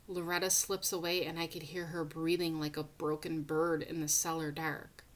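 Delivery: plain reading voice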